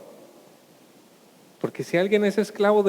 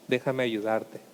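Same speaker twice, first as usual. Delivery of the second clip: gentle Lord; 5 semitones lower, 7 dB quieter than usual. Acted.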